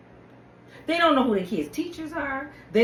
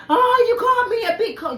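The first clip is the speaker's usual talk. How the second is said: mock outraged reply; 10 semitones higher, 5 dB louder than usual. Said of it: "her reply".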